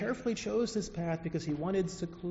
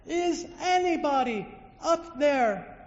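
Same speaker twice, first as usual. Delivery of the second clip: high, loud, slow plea; calling out again; 9 semitones higher, 5 dB louder, slower than usual. Acted. Man hanging from a tree calls again, slow and loud. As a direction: stretch the call upward, pleading. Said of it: "he calls out again".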